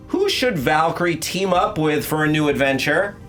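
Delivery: usual speaking voice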